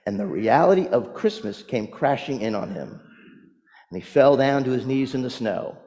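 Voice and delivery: ordinary delivery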